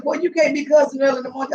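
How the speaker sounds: high, quick mock telling-off; indignant scolding. Woman waking to morning cussing, high quick scolding. Retells scolding Ronnie for cursing.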